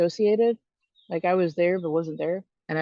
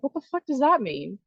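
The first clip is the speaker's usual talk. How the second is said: pitched way up in angry disbelief, rushing at the end; an outraged, sharply raised demand for an answer; incredulous outburst; 8 semitones higher, faster than usual. Retold or imitated. Answering her mentor about the unrestricted case, in high angry disbelief.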